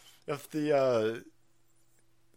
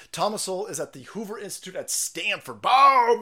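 normal talking voice

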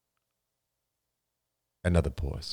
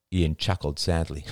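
ordinary talk

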